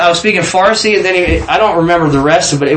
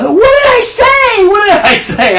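very high, drawn-out alarm; mock panicked outburst; 4 dB louder, slower than usual. Storyteller voicing his next thought after speaking tongues, high mock panic. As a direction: exclaim it in comic self-alarm.

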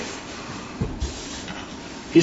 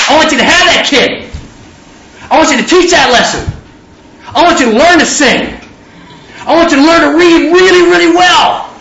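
voicing Jesus; 8 semitones higher than usual. Voicing Jesus giving wishes.

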